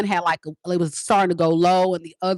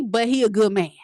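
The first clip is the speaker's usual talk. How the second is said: ordinary delivery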